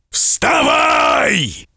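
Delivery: high, strained roar of a furious giant; terrible giant shout. A narrator's reading. Giant looming over the merchant, furious shout. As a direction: bellow it as the giant wakes him. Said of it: "вскричал великан страшным голосом".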